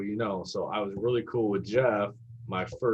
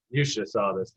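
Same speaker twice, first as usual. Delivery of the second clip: usual speaking voice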